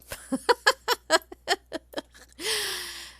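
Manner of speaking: high, loud burst of laughter; real laugh. Real, not acted. Tutor foiled by the software while demonstrating, laughing out loud. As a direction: laugh out loud in a long, helpless peal. Tool will not behave, she laughs.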